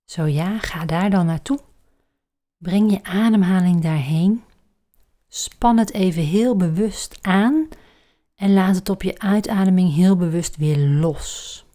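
breathy guided meditation voice; soft spa whisper. Acted. Leads a relaxation exercise.

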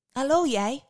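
put-on high lilting girl's hello. A narrator's reading. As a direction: greet the newcomer as a curious girl. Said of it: "zei het meisje".

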